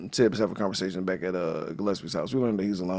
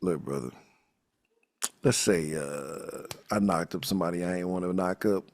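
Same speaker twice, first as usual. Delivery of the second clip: deep, lower voice for the detective's confession; low mock Tibbs; 5 semitones lower, 7 dB quieter than usual. Detective Tibbs asking Harvey for advice, low and confiding. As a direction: drop the voice low and confide it like a man in trouble. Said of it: "He's like".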